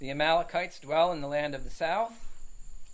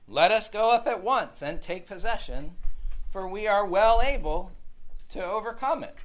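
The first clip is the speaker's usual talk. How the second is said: a touch higher and louder, rallying the crowd; lightly voiced Caleb urging them to go up at once; Caleb's bold call; 6 semitones higher, 6 dB louder than usual. Caleb rallying the people before Moses, mildly voiced bold call. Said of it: "and said".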